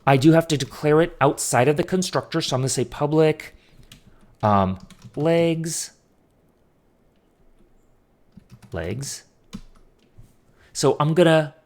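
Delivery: talking while chewing gum; words round a piece of gum. Real, not acted. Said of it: "I can't talk and chew gum at the same time".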